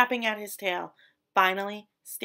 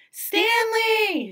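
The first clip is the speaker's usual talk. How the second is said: much higher, louder joyful cheer; 12 semitones higher, 8 dB louder than usual. A narrator's reading. Friends cheering as Stanley returns. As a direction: cheer his name in relief. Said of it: "his friends cheered".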